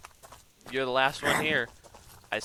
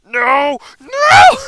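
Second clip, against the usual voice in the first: much higher, very loud screaming no; panicked scream; 23 dB louder than usual. Real, not acted. Gamer screaming as his character goes prone by mistake.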